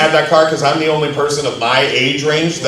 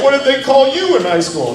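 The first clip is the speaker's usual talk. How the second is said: put-on tearful teen; 11 semitones higher than usual. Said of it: "crying his eyes out".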